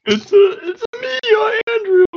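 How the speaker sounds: high strained Mario shout, soul sucked out; dying Mario voice. Acted. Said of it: "It sounded like Mario was getting just, like, his soul sucked out of him".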